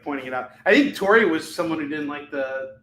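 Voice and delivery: ordinary talk, nothing marked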